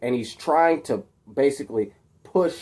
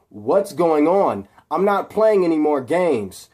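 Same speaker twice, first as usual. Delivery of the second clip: raised, louder demand with rising irritation; uneasy, getting angry; 4 semitones higher, 5 dB louder than usual. Acted. Captive Peter demanding answers from Harry, uneasy and getting angry. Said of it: "like he's getting angry".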